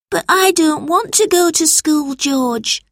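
put-on high whiny child voice; whining little boy. A narrator's reading. Albert whines to George about school. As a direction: whine it reluctantly.

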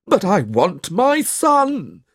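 high, slow, drawn-out whine; protesting father. A narrator's reading. Snawley demanding his son back, protesting. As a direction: protest in a put-on wheedling voice.

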